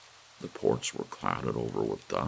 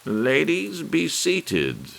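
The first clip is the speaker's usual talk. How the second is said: minstrel fashion; 9 dB louder, much slower than usual. A narrator's reading. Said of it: "in minstrel fashion".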